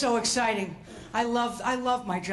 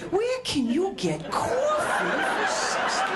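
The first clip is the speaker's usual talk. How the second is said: incredulous father; 7 semitones higher than usual. Retold or imitated. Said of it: "both sitting there thinking".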